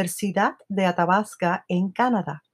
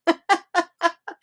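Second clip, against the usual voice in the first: laugh; 10 semitones higher than usual. Real, not acted. Laughing about Siri and mother.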